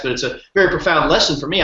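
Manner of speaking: ordinary delivery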